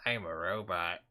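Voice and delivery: deadpan robot monotone. Retold or imitated. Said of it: "When Hillary talks, it's like".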